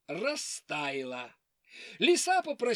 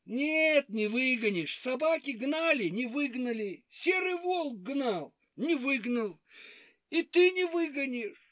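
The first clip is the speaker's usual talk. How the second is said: high hare voice; 4 semitones higher than usual. Acted. Hare doubts bear.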